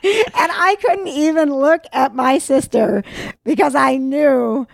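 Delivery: high, slow words through laughter; unable to keep straight-faced. Real, not acted. Telling how she avoided her sister's eye, laughing. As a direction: struggle to get it out while laughing. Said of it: "could not keep a straight face".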